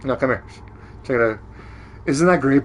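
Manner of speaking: ordinary talk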